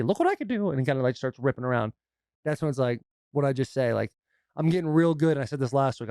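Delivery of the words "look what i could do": put-on high-pitched man-child showing off; Stuart from Mad TV. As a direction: squeal it like a sketch-comedy kid. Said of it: "Stuart from Mad T V".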